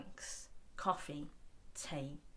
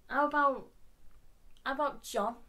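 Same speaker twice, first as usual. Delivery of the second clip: higher, louder, chummy Scouse chat; chatting to the stump; 5 semitones higher, 6 dB louder than usual. Retold or imitated. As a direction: coax the stump like a new mate. Talking to her own stump.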